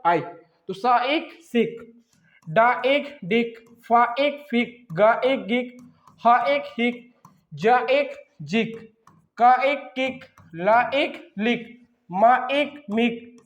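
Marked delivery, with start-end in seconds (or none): none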